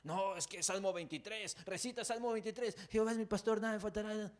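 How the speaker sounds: high, loud frantic recital of the psalm; cling to the verse in panic; a frightened believer reciting Psalm 23 in a rush of nerves; panicked recitation. Retold or imitated. Voices panicked person reciting psalm.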